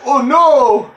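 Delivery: high, loud mock "oh no"; hammed-up dismay; mock boss cry. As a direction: whine it as the beaten boss. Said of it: "He's like".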